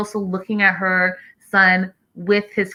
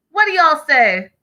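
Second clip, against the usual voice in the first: higher, louder, rallying the viewers; eager repeat to chat; 8 semitones higher, 6 dB louder than usual. Real, not acted. Host rallying her live chat, higher and louder. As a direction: call out to the chat again, pushing for answers.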